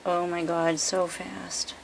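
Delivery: normal talking voice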